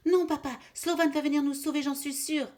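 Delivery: high, loud and fast; panicked child insisting. Acted. Terrified kid answering his father, high and panicked. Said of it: "dit Pitou affolé".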